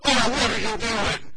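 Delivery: put-on loud startled scold at a toddler's mess; alarmed parent exclamation. Shown as a demonstration. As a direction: catch the kid in the act. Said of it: "and you're".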